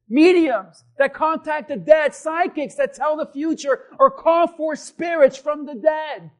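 loud slowing preacher's declaration; full-force preaching. Acted. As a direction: hammer each word for the congregation. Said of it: "preaching at full force".

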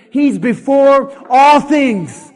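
slow, loud declaration leaning on all; loud recitation. Shown as a demonstration. Pastor leading the congregation in the verse, loud and slow. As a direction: proclaim it together with the church. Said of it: "Can you just say that with me?".

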